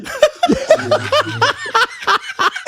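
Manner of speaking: high, loud burst of cackling laughter; loud high cackle. Real, not acted. Uncle cracking up at his own joke, loud high cackle. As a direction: let out a big high cackle.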